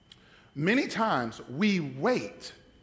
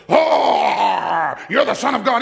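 mock demon cry; 3 semitones higher, 10 dB louder, slower than usual. Enemy confronts Jesus in church.

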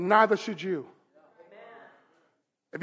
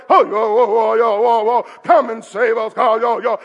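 mock frantic chanting; hammed-up pleading chant; wailing prophets; 4 dB louder than usual. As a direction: babble and wail like a desperate crowd. Mocks Baal prophets' frantic chanting.

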